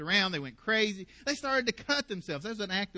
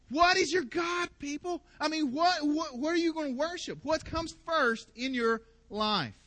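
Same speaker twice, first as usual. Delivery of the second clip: raised, higher calling out to the crowd; challenging call; 6 semitones higher than usual. Retold or imitated. Elijah challenging the people, calling out. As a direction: throw the question out to the people.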